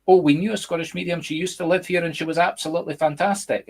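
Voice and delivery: high-pitched, excited retold reaction; imitated gushing. Imitating Americans recalling a Scottish medium, excited gushing. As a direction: gush like an impressed fan, voice lifted high.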